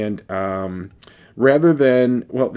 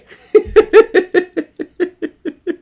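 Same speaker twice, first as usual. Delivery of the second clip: loud high burst of real laughter; 11 dB louder than usual. Real, not acted. Reviewer cracking up at the "eh?" flavor point, loud real laughter. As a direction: crack up helplessly.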